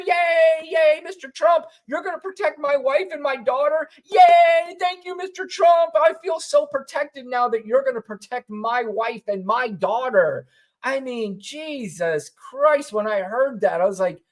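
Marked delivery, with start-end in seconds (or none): 0.1-10.5 mocking cheering fans. Mocking Trump rally crowd, high giddy cheers. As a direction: gush like a dopey rally fan, much higher. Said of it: "the people cheer".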